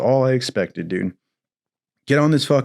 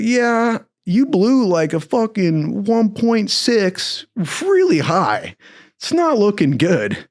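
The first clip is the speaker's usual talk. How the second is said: high, slow, drawn-out nasal-free lady voice; public defender voice; 9 semitones higher, slower than usual. Retold or imitated. Public defender telling him his breath test result, high and slow. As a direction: deliver bad news in a bored high tone. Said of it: "And she's like".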